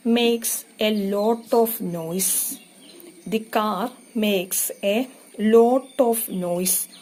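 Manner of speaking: very slow, drawn-out reading; very slow dictation. Shown as a demonstration. Teacher dictating a sentence to students, very slow. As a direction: stretch each word out so students can write.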